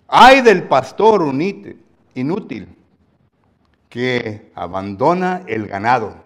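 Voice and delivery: a prophet roaring a reproach; scolding rebuke. Shown as a demonstration. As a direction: thunder it at the negligent shepherds. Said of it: "con ese tono de voz, ¿verdad? Le habló el profeta".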